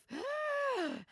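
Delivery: a sudden high gasp of nerves; sharp gasp. Real, not acted. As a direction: catch the breath sharply. Anxious about leaving safe place.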